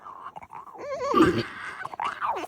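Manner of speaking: squealing high laugh. Real, not acted. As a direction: burst into high giggles. Dog barking, she cracks up.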